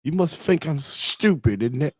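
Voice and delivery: exaggerated British, th turned to f; British accent. Streamer reading British people meme, cheeky British accent. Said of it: "British people be like".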